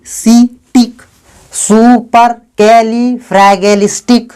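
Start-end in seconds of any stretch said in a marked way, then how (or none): 1.5-4.3 सुपर कैली फ्रैगेलिस्टिक sounded out slowly, each syllable held; teacher's slow model reading of सुपर कैली फ्रैगेलिस्टिक. Shown as a demonstration. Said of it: "इस word को syllable में तोड़ेंगे".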